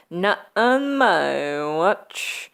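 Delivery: very slow, drawn-out heroic line; mock action hero. McClane defying the hackers, hammed-up hero. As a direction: stretch each word like a hammy tough guy. Voices action hero's defiant reply.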